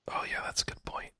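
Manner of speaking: hushed secretive whisper. Pretend. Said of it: "why are we whispering?".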